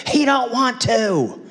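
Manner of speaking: put-on slow, high little-boy West Texas drawl; defiant five-year-old in West Texas twang; West Texas accent. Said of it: "in my very West Texas accent said".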